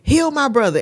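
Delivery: high, loud plea to God; child's urgent prayer. Retold or imitated. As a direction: imitate a little sister praying for her brother. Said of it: "She went into a prayer".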